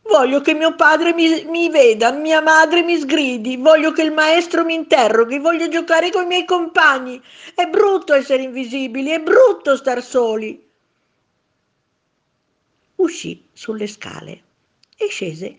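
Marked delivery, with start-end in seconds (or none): 0.0-10.7 heartbroken plea. A narrator's reading. Child's heartbroken plea continues.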